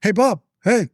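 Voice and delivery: high-pitched phone hello; imitated phone caller. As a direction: pitch the greeting well up, acting out a call. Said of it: "I get the call, and I go".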